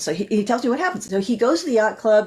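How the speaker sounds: normal talking voice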